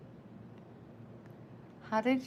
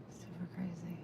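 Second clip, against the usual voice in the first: whisper; 13 dB quieter than usual. Real, not acted. Whispered aside.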